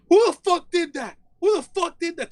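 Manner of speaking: high, loud offended stripper; hammed-up high-pitched outrage; mock angry demand. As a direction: yell it as the affronted dancer. Acts out offended dancer confronting him.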